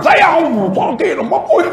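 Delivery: high child's voice, laughing through a mock wail; high, half-laughing mock child wail. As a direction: whimper to mum like a sore little boy. Child wailing to mother.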